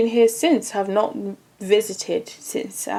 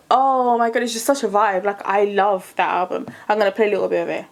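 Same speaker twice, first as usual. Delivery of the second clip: usual speaking voice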